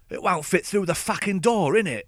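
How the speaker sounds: cockney complaint. Retold or imitated. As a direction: moan it out, mock cockney and aggrieved. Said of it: "one of them said".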